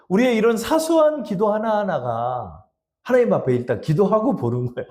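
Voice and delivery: ordinary talk, nothing marked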